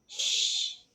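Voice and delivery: soft unvoiced shush; hushing. Wing hushing the president so the house stays asleep, soft shush. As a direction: hush him gently so nobody wakes.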